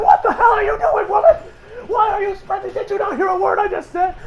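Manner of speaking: high, loud, disbelieving scold; hammed-up shouting at a reckless teammate; mock exasperated yell. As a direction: bellow at her like she's lost her mind. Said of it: "Butanaki's like".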